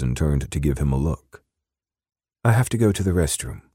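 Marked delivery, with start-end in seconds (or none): 2.4-3.6 higher, quicker British woman's voice; lightly hurried British lilt, pitched up; soft British accent. A narrator's reading. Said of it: "she said in her soft British accent".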